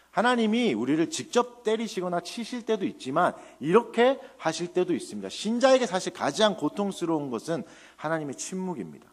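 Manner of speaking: ordinary talk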